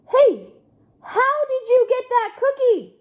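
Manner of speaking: high, loud indignant pigeon. Acted. Pigeon demands of duckling how it got the cookie, high. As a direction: demand to know, outraged.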